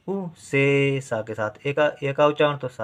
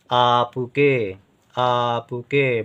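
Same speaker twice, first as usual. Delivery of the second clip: आपके drawn out slowly; slow syllable-by-syllable; much slower than usual. Shown as a demonstration. Teacher to pupil, slow dictation of आपके. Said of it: "बड़ा आ और पा".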